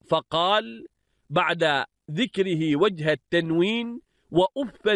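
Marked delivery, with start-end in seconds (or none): 4.3-4.9 وأفا said "wa-uffan", ending in an n; accusative tanwin. Shown as a demonstration. Teacher showing students وأفا as "wa-uffan", with tanwin. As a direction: end وأفا on "-fan". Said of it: "بالنصب والتنوين".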